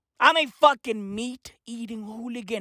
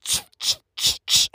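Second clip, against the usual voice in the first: spray bottle noises. Retold or imitated.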